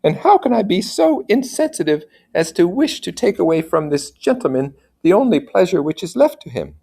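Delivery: noble lady thinking aloud, pitched high; a worried mother wondering to herself. Acted. Mother's inner thoughts.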